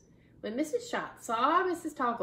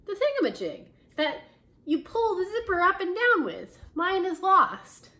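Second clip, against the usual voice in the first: higher, louder wail about the lost part; distressed teacher; 9 semitones higher, 7 dB louder than usual. Acted. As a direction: moan it out in dismay.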